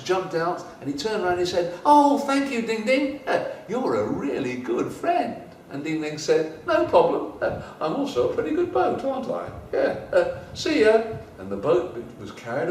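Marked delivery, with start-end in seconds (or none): 1.9-5.4 grateful ant. Acted. 6.7-11.0 high, bright, pleased voice; breezy and proud; Ding Ding waving goodbye, high and chirpy; cheery cap. Acted.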